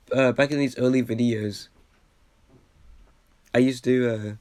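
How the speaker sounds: usual speaking voice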